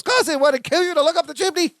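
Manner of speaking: very high, mock-exasperated sitcom cousin; Balky. Cousin objects to Larry's doubts, high imitated protest. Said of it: "And then Balky goes".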